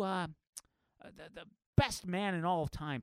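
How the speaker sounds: normal talking voice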